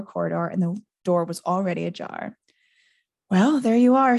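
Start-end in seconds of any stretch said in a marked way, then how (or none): none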